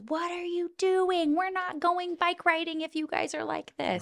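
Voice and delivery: high, drawn-out imitation of a parent yelling; mock yell from upstairs. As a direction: call it out high without real volume. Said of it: "like, yelling from upstairs".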